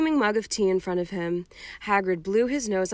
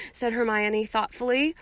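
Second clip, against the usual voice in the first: usual speaking voice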